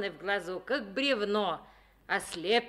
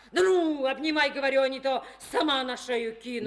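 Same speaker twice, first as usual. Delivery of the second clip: eager demand; 6 semitones higher, 4 dB louder, faster than usual. Acted. Stesha urging him to embrace.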